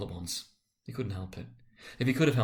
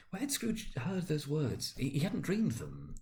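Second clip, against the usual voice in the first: slow wonder; 5 semitones higher, 5 dB quieter, slower than usual. A narrator's reading. Scrooge's wondering thought.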